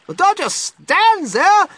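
slow, high, loud wifely scream; frantic, high-pitched demand. A narrator's reading. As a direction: scream at a useless husband. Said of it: "Missus Gloop screamed at Mister Gloop".

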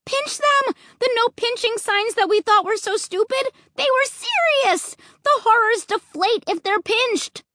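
high, urgent cry of realization; shout. A narrator's reading. Narrator shouts the discovery to the family, high and urgent. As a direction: call it out high and insistent. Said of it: "I shouted to my family".

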